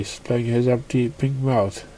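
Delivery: usual speaking voice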